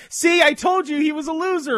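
high taunting gloat, shouted; mocking gloat. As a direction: gloat like a smug fan. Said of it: "you're gonna pop up and go".

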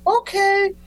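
put-on very high, small boy's okay; little kid reply. A scolded young fan answers his hero in a very high kid voice. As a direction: meekly accept the scolding like a child.

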